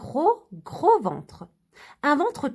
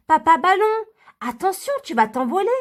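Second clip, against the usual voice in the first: petites filles qui avertissent; 4 semitones higher, 3 dB louder than usual. Acted. Les filles préviennent papa.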